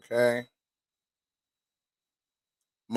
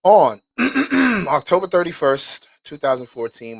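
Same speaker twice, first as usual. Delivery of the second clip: louder, higher formal reading voice, opening the document; 5 semitones higher, 9 dB louder than usual. Acted. Reading the court document to viewers, formal read. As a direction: read it out like an official statement.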